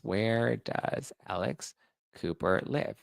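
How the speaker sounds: slow, drawn-out question with a rising end; slow pointed rising question. Shown as a demonstration. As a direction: stretch each word to make the point.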